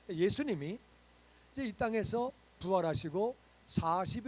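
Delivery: loud, fervent sermon delivery, pressing each point home; full-force preaching. Acted. Pastor preaching to his church, loud and fervent. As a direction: preach it out to the whole congregation.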